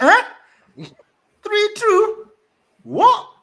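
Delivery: high, loud cry of disbelief at the score; shocked disbelief. Retold or imitated. Reliving the moment he saw the score, a high loud shocked cry. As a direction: yelp it out like you can't believe the score.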